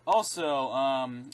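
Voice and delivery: ordinary delivery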